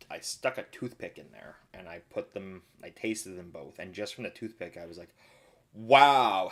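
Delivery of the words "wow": retold wow. Tasting ghost pepper.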